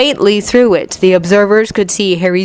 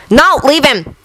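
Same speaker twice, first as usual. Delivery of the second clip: high, sharp call to stop; urgent warning cry; 8 semitones higher than usual. A narrator's reading. Holding back two men rushing to the boy, urgent cry. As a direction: cry out to hold them back. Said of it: "Ragnarok caught their arms".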